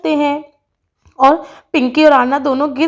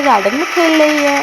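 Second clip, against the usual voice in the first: usual speaking voice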